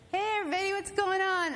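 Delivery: high, loud party hello; host calling out hello to the guests; cheerful greeting. Retold or imitated. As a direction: greet the whole room brightly.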